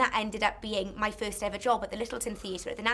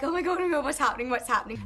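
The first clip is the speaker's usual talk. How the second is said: higher, panicky oh my God; mock panic; 4 semitones higher, 4 dB louder, faster than usual. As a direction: imitate frightened actors in the dark. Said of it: "we were just like".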